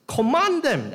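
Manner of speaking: high, loud imperious order; tone of command. Acted. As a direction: order it with full force, pitch leaping up. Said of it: "he uses a tone of command".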